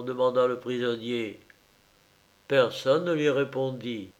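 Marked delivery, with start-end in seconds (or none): none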